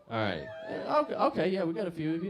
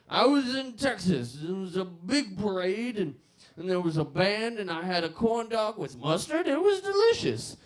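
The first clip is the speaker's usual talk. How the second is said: put-on old drunk storyteller; 7 semitones higher, 5 dB louder than usual. Old alcoholic tells a story.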